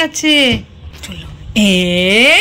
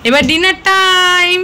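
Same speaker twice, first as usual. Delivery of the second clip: excited calling; 7 semitones higher, 3 dB louder than usual. Real, not acted. Calling dog to dinner.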